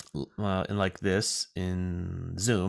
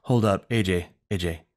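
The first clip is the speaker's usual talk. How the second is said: lightly voiced impersonation, spaced out phrase by phrase; half-hearted star impression with weighty pauses; slower than usual. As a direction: imitate a celebrity loosely, letting each phrase land. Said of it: "I'm doing a terrible [name] impersonation".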